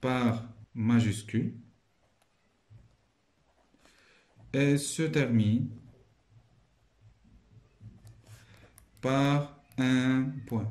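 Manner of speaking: slow, word by word dictation. Shown as a demonstration. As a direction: spell it out slowly for the class. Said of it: "teacher dictating answer slowly". (dictée lente, mot à mot)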